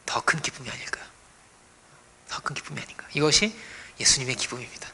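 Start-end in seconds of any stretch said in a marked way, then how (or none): 0.1-1.0 hushed, almost whispered question; soft musing. Real, not acted. Preacher muses softly. 2.3-3.1 breathy whisper, softer still. Real, not acted. Repeated even softer.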